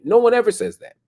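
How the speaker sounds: indignant outburst; exasperated exclamation. Real, not acted. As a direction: throw it out in disbelief. Host exclaims in indignant disbelief.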